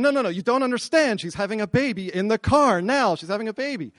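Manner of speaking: high, urgent retold protest; frantic insistence. Husband to unhurried receptionist, frantic insistence. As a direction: make them understand, in acted panic.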